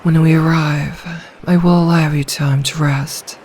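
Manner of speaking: ordinary talk, nothing marked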